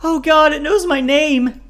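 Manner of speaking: very high, loud drawn-out cry; shrill-pitched, full-voiced panic; terrified wail. A narrator's reading. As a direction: wail it in hysterical terror. Raven terrified of supposed ghost.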